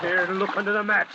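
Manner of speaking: high, pressing call; frantic search. Acted. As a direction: point out the mats in a hurry.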